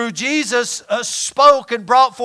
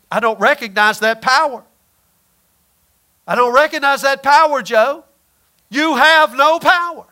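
defiant declaration; 4 semitones higher, 6 dB louder than usual. Acted. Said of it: "I say".

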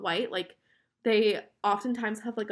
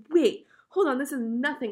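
louder, higher burst of disbelief; sudden surprise; 4 semitones higher, 6 dB louder than usual. Retold or imitated. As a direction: catch yourself in shock. Sudden shock at second dress.

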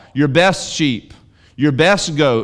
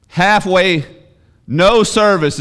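higher, louder burst on "Halfway"; emphatic exclamation; 7 semitones higher, 6 dB louder, much slower than usual. Real, not acted. Preacher to congregation, loud and emphatic. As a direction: hit "Halfway" hard, like an accusation.

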